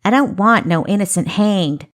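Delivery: loud, put-on Daisy Field accent with shifted vowels. A narrator's reading. Girl stepping into view to declare, Daisy Field accent. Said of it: "pronounced the short O as a short A".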